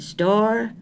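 high, wary "Store" rising at the end; suspicious rising "Store". A narrator's reading. Grocer answering phone, suspicious rising inflection. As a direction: answer the phone as if nobody can be trusted. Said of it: "with a rising inflection that seemed to indicate suspicion".

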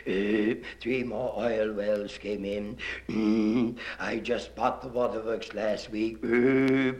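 low, soft, hesitating governor full of ums; slow low voice, lots of uh and mm; governor speaking. Acted. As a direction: mumble the speech like a smug tycoon.

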